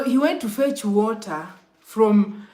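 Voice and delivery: normal talking voice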